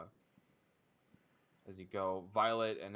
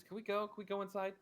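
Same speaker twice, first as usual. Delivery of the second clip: higher, anxious asking; tense and nervous; 13 semitones higher, faster than usual. Acted. Scarlet tenses up and begs to go inside, higher and anxious. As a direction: voice up with worry. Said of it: "She visibly tenses up and says".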